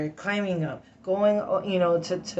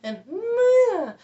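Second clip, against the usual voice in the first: high strained cry; involuntary high wail; tic cry; 8 dB louder than usual. Real, not acted. Upset and apologizing, a strained high tic cry. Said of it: "I'm agitating my tics".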